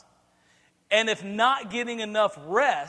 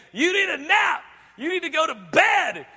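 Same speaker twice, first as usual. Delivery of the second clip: higher, louder scolding order to bed; mock parental yell; 8 semitones higher, 4 dB louder than usual. Tired parent sending a cranky kid to bed, mock yell. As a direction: bark the bedtime order like a fed-up parent.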